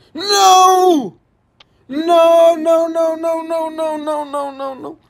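high loud no, fading down; despairing shout. Real, not acted. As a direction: cry out as it all slips away. Falling in game.

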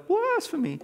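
hammed-up show of outrage; fake outrage. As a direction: act scandalized on the one word. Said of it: "fake, acting outrage".

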